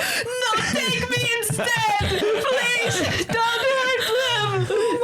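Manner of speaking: high, drawn-out wailing plea; distressed pleading. Acted. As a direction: melodramatic begging through tears, half laughing. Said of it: "she went into distress".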